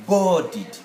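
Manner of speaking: "boarded" very slowly as BOR-did; split by syllable. Shown as a demonstration. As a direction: stretch the first syllable of "boarded", then "did". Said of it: "Look at the syllabic part".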